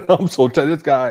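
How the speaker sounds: high, loud words through laughter; exasperated laughing. Real, not acted. Host worn out by this guy, laughing in exasperation. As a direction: laugh helplessly while saying it.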